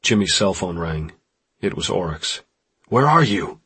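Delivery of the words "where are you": urgent shout, higher and louder; raised, demanding voice. A narrator's reading. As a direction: shout it down the phone. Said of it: "he shouted".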